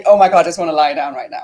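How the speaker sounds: fast mock exasperation; rushed inner thought. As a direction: rattle it off in dismay. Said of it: "'Cause I'm thinking".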